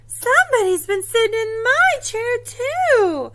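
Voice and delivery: high, loud mother bear voice; Mama Bear. Acted. Seeing her chair sat in, high dismayed mother bear. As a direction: lift it high in storybook dismay. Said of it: "And Mama Bear says".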